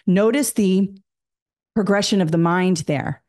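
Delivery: ordinary delivery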